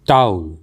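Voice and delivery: टाउल said as "towl", gliding ow; correct pronunciation. Shown as a demonstration. Teacher gives learners the correct word, carefully pronounced. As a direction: say टाउल with no v, one gliding vowel. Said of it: "इसका सही उच्चारण होता है".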